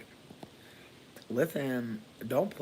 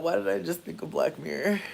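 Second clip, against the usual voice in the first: ordinary talk, nothing marked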